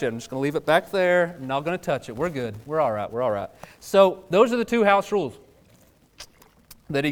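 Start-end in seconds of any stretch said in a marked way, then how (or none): none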